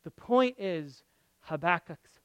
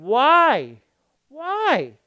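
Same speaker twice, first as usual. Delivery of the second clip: loud rising protest, higher the second time; anguished cry of why; 6 semitones higher, 10 dB louder than usual. Retold or imitated. Believer crying out to God, loud and anguished. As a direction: cry it up at heaven.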